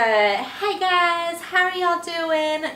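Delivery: a hint of West Texas twang in the greeting; twangy Texan accent. Vlogger imitates a West Texas greeting in a light twangy Texan accent. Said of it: "twangy stuff".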